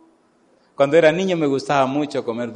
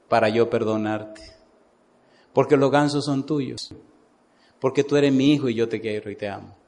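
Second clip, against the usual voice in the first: gentle, lowered, reassuring tone; smiling mother; 5 semitones lower than usual. Retold or imitated. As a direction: speak tenderly, like a mother forgiving her son. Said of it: "La mamá se sonríe, lo mira".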